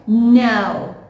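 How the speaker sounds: "now" slow, with the vowel drawn out; stretched now. Shown as a demonstration. Teacher stretching "now" for her class. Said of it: "please stretch it a little bit".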